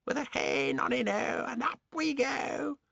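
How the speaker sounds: high, slow chanting crone; singsong old hag. Acted. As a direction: chant it like a giddy witch. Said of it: "she shouted".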